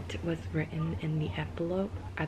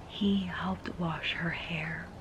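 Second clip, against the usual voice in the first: whispering; 4 dB quieter, slower than usual. Real, not acted. Reading romance aloud, hushed.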